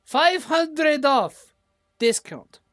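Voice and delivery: high, loud, slow merchant voice; halfling shopkeeper. Acted. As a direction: sell it big like an eager shopkeeper. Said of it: "it is a, uh, halfling woman".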